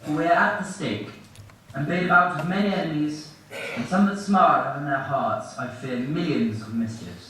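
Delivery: low, measured stage declamation; declaimed Shakespeare. Acted. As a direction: deliver the verse portentously, slowing at the end.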